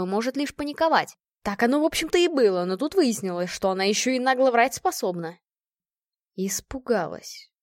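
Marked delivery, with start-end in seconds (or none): 6.3-7.5 slow, cold mocking echo; unkind repeat. A narrator's reading. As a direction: throw her word back coldly. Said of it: "недобро повторила я".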